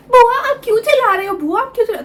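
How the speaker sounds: put-on high child's voice, worried; little nephew's voice. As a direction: play an eight-year-old calling to his aunt. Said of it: "वो ऐसे ऊपर है".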